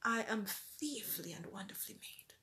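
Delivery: a mirror affirmation spoken in a whisper. Acted. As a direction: whisper the verse to yourself like a secret truth.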